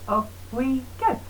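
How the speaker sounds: normal talking voice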